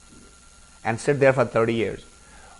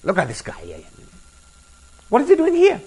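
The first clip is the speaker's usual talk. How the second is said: high, sharp indignant question; indignant disbelief; 10 semitones higher than usual. Retold or imitated. Annoyed meditator reacting to a provoking guy, high indignant outburst. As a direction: sound outraged at the intruder.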